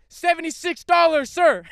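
very high, loud retold shout; mock yell. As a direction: yell the price at a deaf customer. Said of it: "I'm yelling at him".

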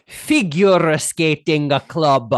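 put-on Russian accent, slow and loud, an extra A on each word; imitating the Russian coach's heavy Russian accent. Imitating the Russian coach to his co-host, heavy Russian accent. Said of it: "That is how she said it".

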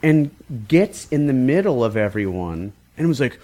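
ordinary talk, nothing marked

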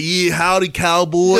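put-on foreigner hamming up a cowboy American accent, laughing; mock American accent. Foreign soldier mocking Americans, in a laughing mock cowboy accent. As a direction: put on a corny Texan twang, laughing through it. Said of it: "in their little, little accents".

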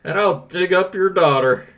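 a hint of a nasal principal; lightly voiced smug school principal. Reads principal Rooney role.

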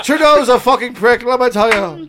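high-pitched Irish brogue; mock Irish accent. Fake Irishman in Canada ranting about Trudeau, mock Irish accent. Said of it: "I'm an Irishman living in Canada".

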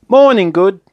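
high, loud, jolly greeting; cheerful. A narrator's reading. Mister Topsy-Turvy greets his visitors, cheerfully. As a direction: sing out a hearty hello. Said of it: "he said cheerfully".

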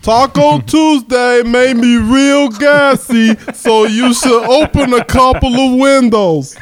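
slow stuffed-up comic voice; stuffy-nosed character. Acted. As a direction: stretch it out through a blocked nose. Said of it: "gonna give you fat lazy guy".

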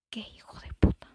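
whispered curse under the breath; whispered thought. Acted. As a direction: hiss it as a private thought. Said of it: "Es lo primero que pienso". (insulto susurrado para sí)

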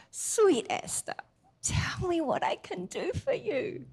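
high, soft, slow loving question; tender king. Retold or imitated. King asks sweet Esther, softly and tenderly. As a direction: coax her gently.

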